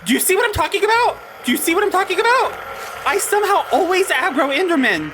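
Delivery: high, fast panicked shouting; outraged yelling. Real, not acted. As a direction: yell in disbelief while under attack. Chased by Enderman she avoided.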